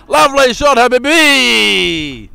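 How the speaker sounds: loud, high cheer for the shot; excited shout. Real, not acted. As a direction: stretch out Habibi in delight. Commentator cheers a big shot.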